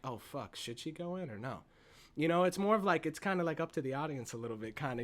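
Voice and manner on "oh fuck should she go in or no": soft, low uncertain murmur; hushed doubt. Retold or imitated. Audience doubting the guest, soft and unsure. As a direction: wonder quietly to yourself.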